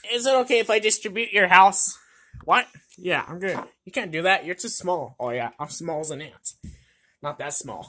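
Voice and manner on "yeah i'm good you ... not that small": ordinary delivery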